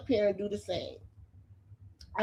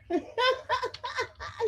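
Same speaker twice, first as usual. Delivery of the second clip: sudden high-pitched cackle; high mocking laugh. Real, not acted. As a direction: laugh at the punchline. Laughing at her own insult.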